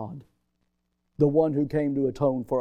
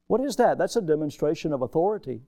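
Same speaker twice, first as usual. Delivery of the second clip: ordinary delivery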